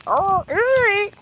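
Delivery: high, slow, spooked "Oh, eerie". Retold or imitated. Spooked by a strange noise.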